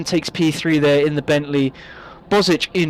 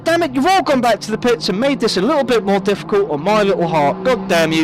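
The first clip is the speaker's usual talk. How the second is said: play-by-play commentary; 7 semitones higher than usual. Real, not acted. Lively commentary on the laps.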